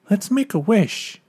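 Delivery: high, eager suggestion; excited child. A narrator's reading. Child asking Dad to wish on a star, excited.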